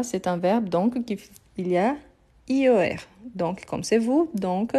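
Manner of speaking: ordinary talk, nothing marked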